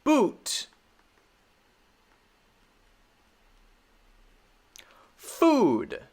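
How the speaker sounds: "Boot" and "Food" with a long drawn ooh; teacher modelling the long ooh; long oo demo. Shown as a demonstration. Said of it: "same ooh as in my name".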